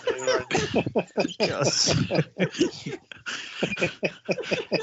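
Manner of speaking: long wheezing laugh fit; hoarse raspy laugh. Real, not acted. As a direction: laugh till out of breath. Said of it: "whilst you catch your breath".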